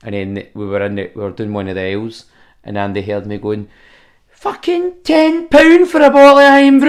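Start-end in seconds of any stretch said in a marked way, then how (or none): 4.3-6.9 loud, high Scottish outburst at the price; outraged shout. Retold or imitated. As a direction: yell it in disbelief at the price.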